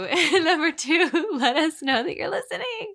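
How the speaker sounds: high, loud, half-laughing near tears; tearful pleading. Real, not acted. Co-host begging listeners for feedback, tearful pleading. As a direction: plead through laughter and a tight throat. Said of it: "If you wanna hear me cry".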